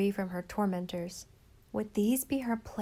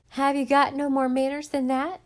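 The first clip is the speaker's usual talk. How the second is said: loud, higher jeering yell; yelling girl; 5 semitones higher, 7 dB louder than usual. A narrator's reading. Harbor girl yelling at Nan for her manners, loud jeer. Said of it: "yelled a girl".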